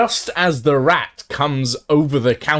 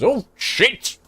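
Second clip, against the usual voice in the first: high-pitched startled yelp; Jeremiah, startled; 10 semitones higher than usual. Acted. Shopkeeper looks behind him at the burning wall, startled. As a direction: blurt it in sudden alarm. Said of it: "he looks behind him and he goes".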